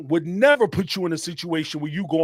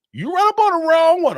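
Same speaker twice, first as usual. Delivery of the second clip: higher and much louder burst on the repeat; loud warning; 10 semitones higher, 8 dB louder than usual. Real, not acted. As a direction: hit it hard to drive the point home.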